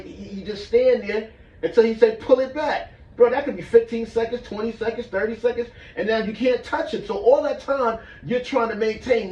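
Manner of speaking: high and loud, straining through it; strained and worked up. Retold or imitated. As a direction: push it out like the strain is happening now. Recalls holding the strain for long.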